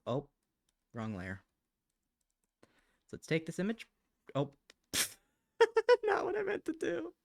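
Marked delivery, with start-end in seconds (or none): 4.9-7.1 high, loud squeal through a laugh; a squeak of laughing dismay. Real, not acted. Laughing at drawing mistake.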